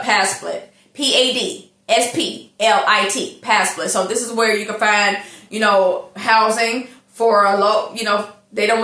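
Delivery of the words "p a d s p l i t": spelled out. Shown as a demonstration. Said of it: "look it up".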